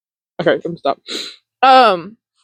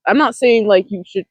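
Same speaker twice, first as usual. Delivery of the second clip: ordinary talk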